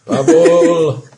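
loud call, half laughing. Acted. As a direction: yell the name down the tunnel. Calling out again, laughing.

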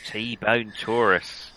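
very drawn-out syllables; slow, careful accent practice; slow reset phrase. Shown as a demonstration. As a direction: stretch each word to reset the accent.

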